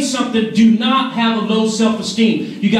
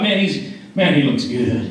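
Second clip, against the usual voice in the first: wife's thoughts, joking; 11 semitones lower than usual. Retold or imitated. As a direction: voice drops much lower, admiring with a chuckle. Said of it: "she thought".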